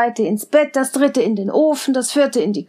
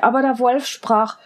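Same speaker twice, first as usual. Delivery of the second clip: plain reading voice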